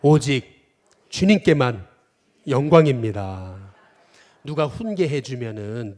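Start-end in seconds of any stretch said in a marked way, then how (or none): 0.0-3.7 louder and slow, spaced for the crowd to echo; preacher leading a call-and-response; slow call to repeat. Shown as a demonstration. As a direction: declaim it slowly so everyone can repeat it. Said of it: "또 따라해 보겠습니다".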